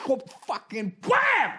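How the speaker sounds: high, drawn-out whoop and bam; shouted impact noises. Retold or imitated. Comic acting out a knockout uppercut, shouted sound effects.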